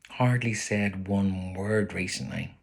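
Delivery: ordinary delivery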